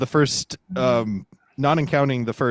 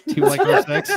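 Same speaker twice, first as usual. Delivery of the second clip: mock high voice; 4 dB louder than usual. Reading the stranger's message.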